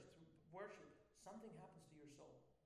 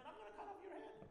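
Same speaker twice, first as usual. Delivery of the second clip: "head" pitched much higher than the line before; fierce challenge. Shown as a demonstration. Preacher threatens his resentment in a high fierce voice. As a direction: fling the threat up high at your problem. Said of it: "imagine talking to your problems like this".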